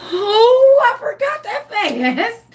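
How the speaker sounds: high, loud "oh" easing into drawn-out words; flustered exclamation. Real, not acted. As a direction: caught yourself slipping, high-pitched and drawn out. Cussed again with niece listening.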